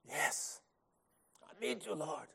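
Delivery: barely voiced, very soft prayer; hushed private plea to God; silent-prayer hush. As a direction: pray it under your breath, as if only thinking it. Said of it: "If you can't talk, think it".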